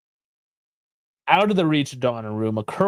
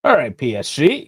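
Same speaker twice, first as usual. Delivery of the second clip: usual speaking voice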